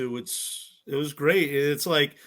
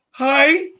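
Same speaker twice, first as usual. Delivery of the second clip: high, loud, eager hi; overexcited imitation greeting; mock starstruck hello; 11 semitones higher, 11 dB louder than usual. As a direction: squeak out a fan's hello. Acts starstruck reply to host.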